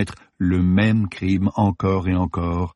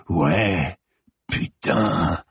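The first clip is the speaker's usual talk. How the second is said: growled, lower; much slower than usual. A narrator's reading. Ronan growling his arousal at Samira, lower. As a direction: keep the voice low and dark. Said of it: "une note différente, très basse".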